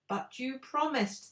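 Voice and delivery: pleading. A narrator's reading. Friends protesting.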